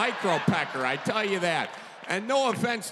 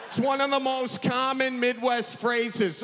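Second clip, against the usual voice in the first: ordinary talk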